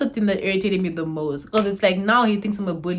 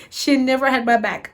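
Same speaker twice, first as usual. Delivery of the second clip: normal talking voice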